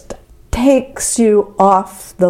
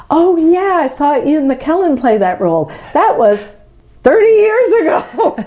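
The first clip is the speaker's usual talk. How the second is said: put-on grand old lady; 9 semitones higher than usual. Mock-grand reminiscing quote.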